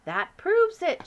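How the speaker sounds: loud, slow, high; triumphant queen. Acted. Queen convinced by the giant, triumphant. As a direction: declare it proven.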